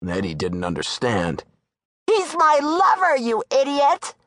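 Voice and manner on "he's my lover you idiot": high woman's voice, snapping; angry Darla. Acted. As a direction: scorn him for not getting it.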